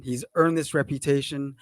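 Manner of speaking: normal talking voice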